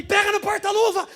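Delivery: high, loud order to grab it; urgent shout. Retold or imitated. Husband shouting at his wife to open the glove box. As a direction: bark it at your wife in a panic. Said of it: "Virei pra minha esposa e falei".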